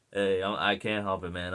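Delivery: usual speaking voice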